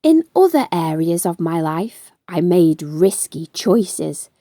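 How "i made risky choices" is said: ordinary delivery, nothing marked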